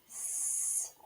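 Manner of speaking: long voiceless s hiss; hissed S sound. Shown as a demonstration. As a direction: hiss the S- alone. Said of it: "what letter makes the sound S".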